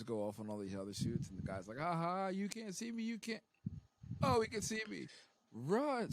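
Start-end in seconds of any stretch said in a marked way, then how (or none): 1.8-3.4 high gloating sing-song; taunting. Retold or imitated. Cadet taunting, still thinks invisible. 4.2-6.1 high, loud sudden panic; panicked. Retold or imitated. Cadet realizing he's visible.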